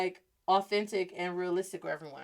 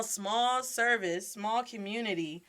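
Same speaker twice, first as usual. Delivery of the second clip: ordinary delivery, nothing marked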